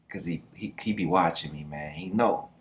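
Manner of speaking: ordinary delivery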